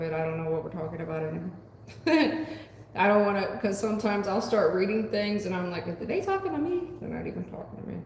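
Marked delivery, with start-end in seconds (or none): 1.9-2.9 brief high laugh; short laugh. Real, not acted. Reader confused by viewers' chatter, short laugh. 5.9-6.9 high-pitched questioning self-quote; mock puzzlement. Reader wonders if chatters mean her, mock puzzlement. Acts out own confused thought.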